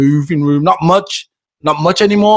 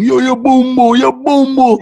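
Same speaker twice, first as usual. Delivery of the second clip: mock rowdy youth chant, higher; abrasive young Jamaican; 8 semitones higher, slower than usual. Friend mimics young Jamaicans being brash. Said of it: "young and all abrasive".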